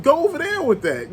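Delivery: high, sassy wave-off; dismissive brush-off. Retold or imitated. Telling complainers to take it elsewhere, high and dismissive. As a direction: shoo them away with a high, scornful lilt. Waving off people who complain.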